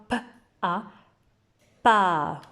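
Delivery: P and A apart, then pa loud; teacher blending sounds; sounding out a syllable. Shown as a demonstration. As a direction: model P, A, pa for children. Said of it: "Vous redites avec moi?".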